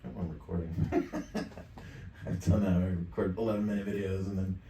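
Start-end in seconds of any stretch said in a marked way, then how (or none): 0.8-2.2 quiet chuckle; soft, unvoiced little laugh; laugh at himself. Real, not acted. Realizing the recording was on, chuckling at himself.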